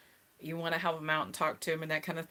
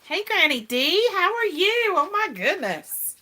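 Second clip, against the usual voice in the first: much higher and louder, slow delighted hello; excited greeting; 13 semitones higher, 12 dB louder, slower than usual. Real, not acted. Greeting Granny D arriving in chat, delighted and loud. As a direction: greet her like a star just walked in.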